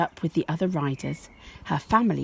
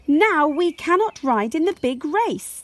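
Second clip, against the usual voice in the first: lightly voiced complaint; 10 semitones higher, 4 dB louder than usual. Injured family complain.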